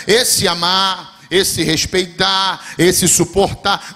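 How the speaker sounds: each duty drawn out slowly; slow emphatic list. Acted. Preacher listing love's duties to the church, slowly. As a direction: weigh every word in the list.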